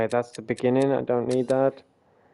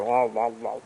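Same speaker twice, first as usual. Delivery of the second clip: mock "wah, wah, wah" babble; imitated tongue flapping; 6 dB quieter than usual. Streamer showing how their tongue tangles on T's, mock babble. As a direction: flap through loose wah noises. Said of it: "my tongue is like".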